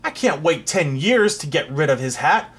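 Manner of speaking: a king shouting the magicians away; angry shout. Acted. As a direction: bellow it at the fools. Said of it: "he shouted".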